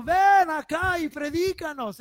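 loud, high shout calling across; pleading call. Retold or imitated. Man of Macedonia calling Paul to come, loud high shout. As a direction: call out begging them to come. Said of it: "un hombre de Macedonia que estaba diciendo".

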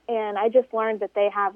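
ordinary talk

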